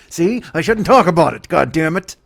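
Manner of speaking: loud, high-pitched frustrated yelling; exasperated shouting. Real, not acted. Comedian scolding himself for bringing it up, exasperated shout. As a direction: blow up at yourself, voice jumping up.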